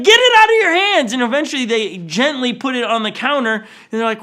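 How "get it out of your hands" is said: higher, louder alarmed shout; freaking out. Retold or imitated. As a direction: bark the order in a panic. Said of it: "he starts to freak out on him".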